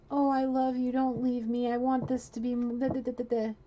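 a hint of a woman begging him to stay; imitating her pleading. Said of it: "it's her saying that to him".

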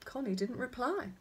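usual speaking voice

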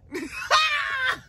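very high, shouted laugh; high cackling laugh; falsetto shriek of laughter. Real, not acted. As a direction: burst into a squealing laugh. Laughing after falsetto bit.

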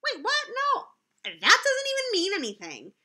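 squeaky, high pretend shock; mock surprise. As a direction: act baffled by the word. Reader protests silly words.

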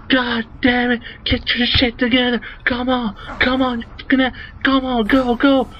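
high, strained mock-yelling rant; angry yelling. Teenager yelling at his seven-year-old brother while he drives, angry rant. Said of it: "I'm just yelling at him, saying".

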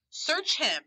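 storyteller's mild shriek of command; mock shriek. A narrator's reading. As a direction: shriek the order without full volume. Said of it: "shrieked the blind man".

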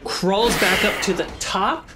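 usual speaking voice

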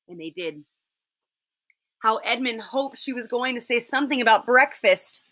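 ordinary delivery, nothing marked

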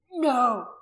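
loud, forceful refusal; desperate shout. A narrator's reading. Held man stopping the woman speaking, loud shout. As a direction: shout to prevent it.

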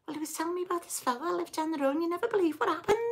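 high, rapid-fire Scouse chatter; fast Scouse accent. Retold or imitated. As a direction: rattle it off excitedly in a thick Scouse accent. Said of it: "I talk faster".

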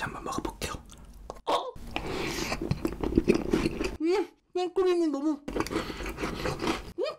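talking while chewing cream; muffled through food; mouth full. Real, not acted. Seen 3: Cream on lips, cheeks puffed, chewing while speaking the words.

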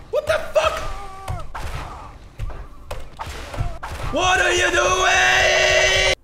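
high, stretched-out shout of disbelief; a high, drawn-out what-are-you-doing yell; disbelieving shout. Real, not acted. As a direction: scream it slowly in outrage. Yelling at game character.

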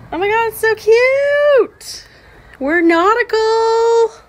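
high, loud gushing; delighted squeal. Real, not acted. Seeing the cute nautical room, delighted squeal.

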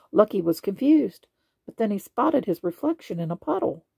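plain reading voice

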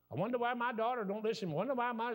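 high-pitched, mock-puzzled grumbling; imitated parent complaint. Parent complaining about a daughter, imitated exasperation. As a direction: act out a parent lamenting an unheeding child.

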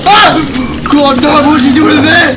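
real jump-scare shout, high and loud; startled yell. Real, not acted. Gamer finds someone inside the closet, startled yell. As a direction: yell out in shock, pitch jumping up.

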